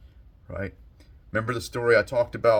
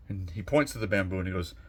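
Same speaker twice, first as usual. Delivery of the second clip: ordinary delivery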